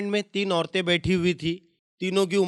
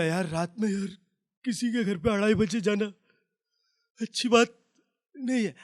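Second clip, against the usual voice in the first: lost-voice reply; 2 semitones higher than usual. Retold or imitated. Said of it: "मेरी आवाज़ बैठ गई".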